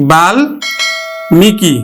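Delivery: Valmiki stretched very slowly, syllable by syllable; slow, deliberate model of Valmiki; slow teaching demo. Shown as a demonstration. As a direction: draw out Valmiki slowly so the ম-sound is heard. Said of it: "উচ্চারণ করছি কিন্তু".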